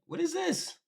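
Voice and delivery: usual speaking voice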